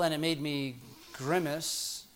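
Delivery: normal talking voice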